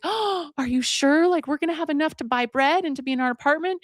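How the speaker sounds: gasp, then high-pitched disbelief; acted overwhelmed storybook mother; astonished gratitude. Evicted mother given a necklace, astonished.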